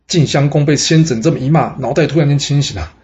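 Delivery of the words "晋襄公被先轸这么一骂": ordinary delivery, nothing marked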